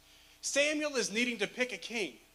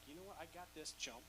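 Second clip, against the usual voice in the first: cocky; 6 semitones lower, 11 dB quieter, faster than usual. Retold or imitated. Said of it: "with his chest like".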